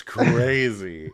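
very slow mock-outraged aside; sarcastic drawl. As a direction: drag it out sarcastically.

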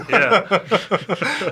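laughing yeah. Real, not acted. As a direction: laugh through it. Laughs at own joke.

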